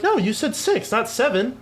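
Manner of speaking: high whiny protest; indignant childish pitch; kid protesting. Retold or imitated.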